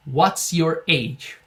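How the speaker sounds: very slow, drawn-out English model; slow model phrase. Shown as a demonstration. Teacher drills the alternative question very slowly. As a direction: stretch every word for the class.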